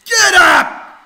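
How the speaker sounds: a sudden high, loud yell; shouted. Real, not acted. Ranting boy screaming at his rival, very high and loud. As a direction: blast it at full lung.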